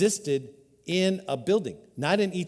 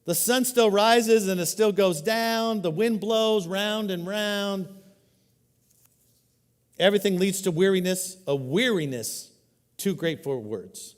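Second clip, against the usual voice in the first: weary scripture reading; 4 semitones higher, 3 dB louder, slower than usual. Acted. Reads Solomon's weary lament.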